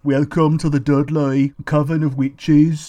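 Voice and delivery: put-on slow, drawn-out Dudley accent. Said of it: "especially like Dudley".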